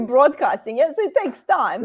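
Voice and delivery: ordinary talk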